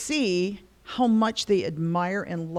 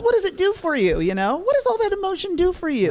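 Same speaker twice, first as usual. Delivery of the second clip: high, insistent fatherly questioning; mock parental challenge, pitched high; imitating her dad; 12 semitones higher, 5 dB louder, faster than usual. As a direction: press the point like an exasperated dad. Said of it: "He goes".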